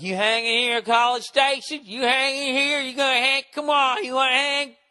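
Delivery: put-on high, loud drunken pestering; loaded drunk guy. Drunk jerk badgering strangers to stay, loud mocking imitation. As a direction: push it up high like a pushy drunk. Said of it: "he's completely loaded".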